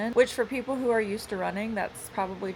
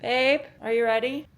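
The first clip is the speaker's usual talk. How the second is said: higher, louder worried call; concerned; 6 semitones higher, 7 dB louder than usual. Real, not acted. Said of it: "why did you say that with, like, concern in your voice".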